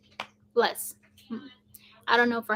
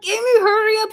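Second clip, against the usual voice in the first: high, raised hurry-up; mock exasperated call to rush her; urgent call; 9 semitones higher, 5 dB louder, faster than usual. Retold urging of slow sister.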